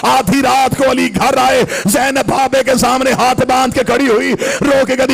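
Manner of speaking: chanted, mournful majlis recitation; impassioned lament preaching. Acted. Zakir telling of Zahra pleading at the door, chanted lament. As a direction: plead through the story in a sustained chant.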